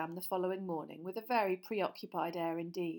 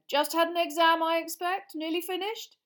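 high, loud hearty small talk; chatty official, too friendly; Fudge voice; 10 semitones higher, 8 dB louder than usual. Acted. Minister making small talk with the boy, high and hearty.

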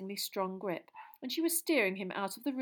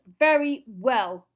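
slow, much louder, weighted words; cold cutting reply; 4 semitones higher, 13 dB louder, much slower than usual. Acted. Teacher silencing the pleading boy, slow and loud. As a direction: drag it out and slam it down to end the argument. Snape cuts Colin off.

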